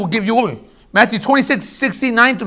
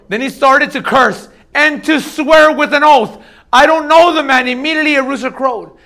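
forceful scripture reading; 5 semitones higher, 4 dB louder than usual. Acted. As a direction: preach the denial out loud.

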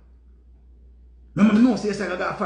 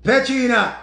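name called out at raised pitch; calling out; 4 semitones higher, 4 dB louder than usual. Real, not acted. Streamer calling Petrina to comment, calling out. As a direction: summon her, a little higher.

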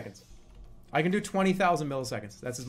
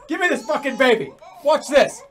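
raised, high, loud demand; loud takeover; 7 semitones higher, 11 dB louder than usual. Pretend. Player grabbing the pretend baby, loud.

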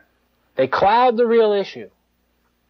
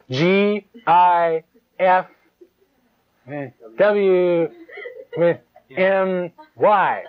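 slow spelled letters; much slower than usual. Shown as a demonstration.

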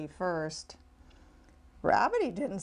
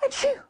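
big sneeze. A narrator's reading. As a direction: burst out with a sneeze. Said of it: "it made him sneeze".

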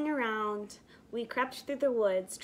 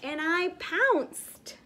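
mock pounce cry; 7 semitones higher, 5 dB louder, much slower than usual. Child pounces on lady.

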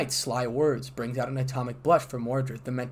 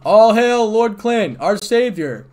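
crowd hailing; 9 semitones higher, 13 dB louder, slower than usual. A narrator's reading. Crowd of dragons hails lord.

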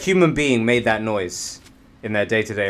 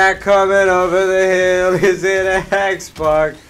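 ominous questioning, pitched up; mock dread; 7 semitones higher, 6 dB louder than usual. Enemy approaching.

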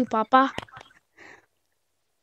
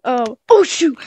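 ordinary talk, nothing marked

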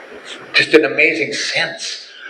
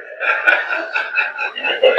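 short unvoiced chuckle; breathy laugh. Real, not acted. Laughs at memory.

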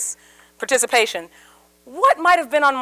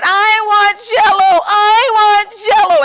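put-on high, loud, slow toddler whine, close to crying; nails-on-the-chalkboard whining for Jell-O; shrill whiny child; 7 semitones higher, 8 dB louder, slower than usual. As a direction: whine it like a four-year-old in a tantrum. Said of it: "in this annoying, shrill, nails on the chalkboard kind of voice".

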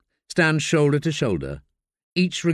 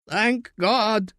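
voice cracking; 9 semitones higher than usual. Acted. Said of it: "his voice cracking".

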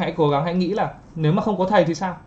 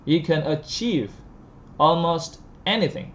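slow model read; slower than usual. Shown as a demonstration. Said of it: "slow model line".

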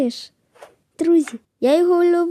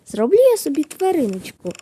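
usual speaking voice

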